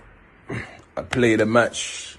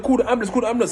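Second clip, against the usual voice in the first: much higher, urgent repeated order; urgent plea; 12 semitones higher, much faster than usual. Retold or imitated. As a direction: beg them to call help. Urges ambulance in panic.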